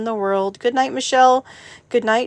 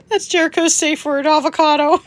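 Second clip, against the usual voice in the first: giggling through the words, higher and louder; laughing; 7 semitones higher, 5 dB louder than usual. Real, not acted. Streamer cracking up at her own joke, laughing. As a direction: laugh it out as you speak.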